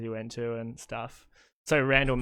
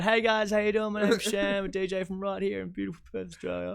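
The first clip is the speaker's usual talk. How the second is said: higher-pitched, upbeat presenter patter; mock video intro; 8 semitones higher than usual. Host voicing over own tour video for friends, mock presenter intro. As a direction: re-enact your own video greeting. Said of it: "I'm just going".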